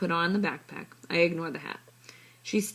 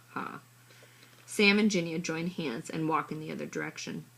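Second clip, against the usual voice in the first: ordinary delivery, nothing marked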